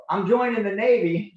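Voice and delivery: loud and high, laying it down; defiant announcement. Retold or imitated. Son telling his mom he is enlisting, loud defiant declaration. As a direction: announce it like it's settled.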